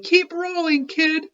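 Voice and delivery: high, loud boulder yell. Acted. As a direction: shout him down the hill.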